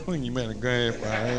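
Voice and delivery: soft, low, timid retreat; meek backing-down daddy. Retold or imitated. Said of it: "your big daddy will look at it and go".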